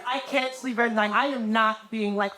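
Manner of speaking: normal talking voice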